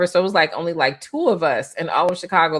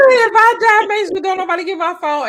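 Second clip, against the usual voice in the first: excited retelling; 12 semitones higher, 5 dB louder than usual. Retold or imitated. Retold punchline, loud and high.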